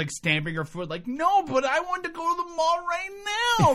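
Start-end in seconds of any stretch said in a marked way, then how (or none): 1.1-3.7 put-on high, whiny teen demanding the mall; whiny teenager. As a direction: stamp your foot and whine like a spoiled teen. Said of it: "she just sounds like a whiny teenager".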